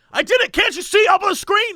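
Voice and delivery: high, indignant yell; mock shouting. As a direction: imitate a furious customer insisting he's right. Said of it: "just by shouting at people".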